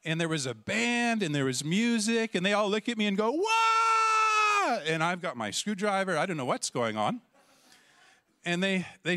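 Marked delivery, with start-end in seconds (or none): 3.3-4.8 loud, very high whooping cheer; imitated crowd roar.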